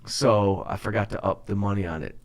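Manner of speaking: ordinary talk, nothing marked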